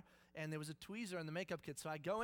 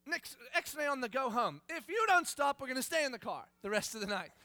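angry threat; 8 semitones higher, 9 dB louder than usual. Retold or imitated. Dad escalates threat in car.

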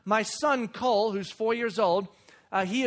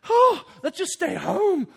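weary parent; 7 semitones higher, 6 dB louder, slower than usual. Retold or imitated. I'm exhausted.

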